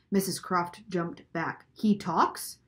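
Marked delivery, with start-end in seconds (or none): 1.7-2.6 mildly startled question, rising at the end; shrill astonishment. A narrator's reading. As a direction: ask it in startled disbelief. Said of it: "her voice shrill and high".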